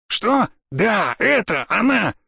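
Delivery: high, drawn-out cry of discovery; slow, stretched, elated exclamation; overjoyed recognition. Acted. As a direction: realise with mounting delight that it is found. Spots the box he sought.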